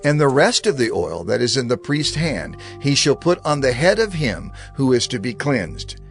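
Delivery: ordinary talk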